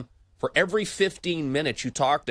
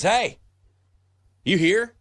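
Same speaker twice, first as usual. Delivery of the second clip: higher, louder mock call of someone barging in; imitating handyman calling out; 9 semitones higher, 6 dB louder, slower than usual. Handyman Kevin announcing his arrival, mock loud call. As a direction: call it out like he just walked in.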